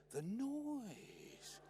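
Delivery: high, soft, long drawn-out mock agony; pained hushed moan stretching the last word; very quiet, pained.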